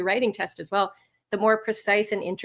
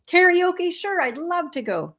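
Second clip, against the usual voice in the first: keen acceptance; 8 semitones higher, slower than usual. Acted. Acting a keen reply to invitation.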